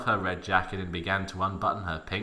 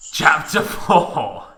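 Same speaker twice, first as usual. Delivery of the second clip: slow, loud ominous chapter title; dramatic announcement; 5 semitones higher, 7 dB louder, much slower than usual. Acted. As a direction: announce it like a doom trailer. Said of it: "dramatic chapter announcement".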